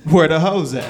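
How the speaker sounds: booming repeat of the driver's question; mock loud driver.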